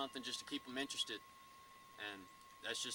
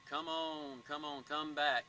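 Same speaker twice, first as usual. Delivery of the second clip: slow, coaxing plea to come back; drawn-out begging come on; pleading; 4 semitones higher, 4 dB louder, much slower than usual. Retold or imitated. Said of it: "more of a pleading call".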